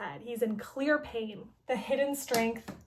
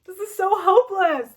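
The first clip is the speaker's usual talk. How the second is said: despairing cry; 7 semitones higher, 10 dB louder than usual. Retold or imitated. Despairing heroine line in story.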